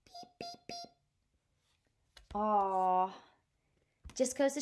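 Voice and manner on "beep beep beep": very soft, high horn beeps; tiny quiet beeps to end the book; a gentle high beep beep beep. Acted. Closing honks of story.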